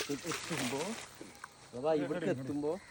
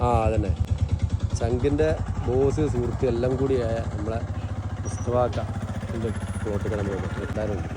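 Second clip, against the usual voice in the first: malayalam english mix; 12 dB louder than usual. Acted. Loud vlog host intro.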